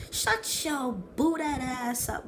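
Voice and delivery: high, drawn-out mock outrage; mock scolding. Vlogger telling off a cultist, mock scolding.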